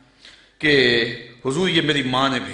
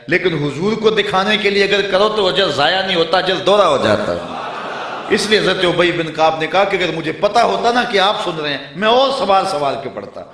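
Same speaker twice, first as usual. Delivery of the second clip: louder, higher preacher's fervour; fervent preaching; 4 semitones higher, 5 dB louder than usual. Acted. Preacher urging listeners, louder and fervent. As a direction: drive the point home to the congregation.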